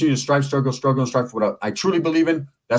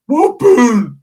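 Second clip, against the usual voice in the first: high, loud hype shout; whooping shout; 10 semitones higher, 8 dB louder than usual. Acted. Narrator hyping up the kid's whip, loud shout. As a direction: yell it out hyped up.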